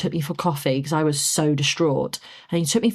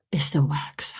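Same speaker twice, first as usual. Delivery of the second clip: deflated whisper, retelling the moment; whispered admission; 4 semitones lower, 4 dB quieter, slower than usual. Retold or imitated. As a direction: whisper it on the tail of a sigh. Retold realisation after checking numbers.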